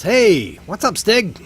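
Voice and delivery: put-on a big friendly beast hailing an old pal; cheery greeting. Dragon greets Stig over the cages, high hearty call. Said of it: "he goes".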